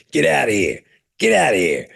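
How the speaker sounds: croaky, gruff seal imitation; talking seal voice. Imitating the talking seal answering visitors, gruff croak. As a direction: bark it out like the Boston seal. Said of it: "they keep playing that seal going".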